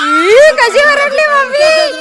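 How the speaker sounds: slow, high wailing shout; crying shout. Real, not acted. Child wailing about how mommy shouted, crying shout. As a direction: wail it out, crying. Mock cry over mother's scolding.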